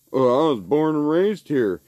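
sneering mock of a proud local. Mocking locals who boast they were born there, sneering voice. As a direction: parrot them with contempt. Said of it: "You wanna be claiming Alaska?".

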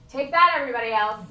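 loud, high gleeful taunt; laughing triumphant call. Real, not acted. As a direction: crow it at everyone, laughing.

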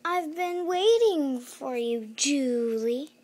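loud, slow, high villain greeting; sinister villain. Acted. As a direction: draw it out like a gloating villain.

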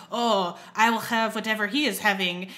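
badly faked French accent drifting toward Russian; horrible French accent. Podcaster imitating Skinner ordering in disguise, horrible French accent. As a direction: play a disguised diner with a clumsy French accent. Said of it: "Sorry about my horrible French accent earlier".

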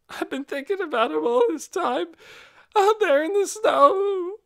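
sob in the voice. Acted. Amanda confessing to Nikki, sobbing. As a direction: let a sob rise on snow. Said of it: "a sob came up inside the word".

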